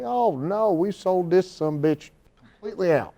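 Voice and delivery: high excited Southern promoter; drawling promoter. Retold or imitated. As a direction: play the thrilled small-town promoter. Promoter voiced, much higher.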